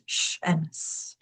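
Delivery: "sh and s" said slowly, each sound hissed on its own breath. Shown as a demonstration. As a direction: hold out the "sh" and then the "s" so they can be compared. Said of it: "the difference between these two minimal pairs".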